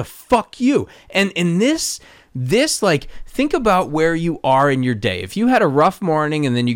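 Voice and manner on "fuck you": high, scornful snap; mock fuck-you. Shown as a demonstration. Naming the hostile reading of the text, scornful. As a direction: toss it off as a hostile brush-off. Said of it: "one's a".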